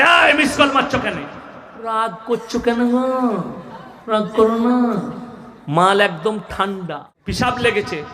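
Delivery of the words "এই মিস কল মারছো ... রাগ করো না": coaxing wife. Retold or imitated. Wife calling back sweetly.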